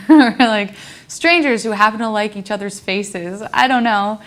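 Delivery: giggling through a joke about online friends; laughing. Real, not acted.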